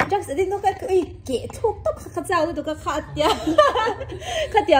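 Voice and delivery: high-pitched, talking through laughter; real giggles breaking into the words; laughing. Real, not acted. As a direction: tell it while cracking up. Teller breaks up at own story.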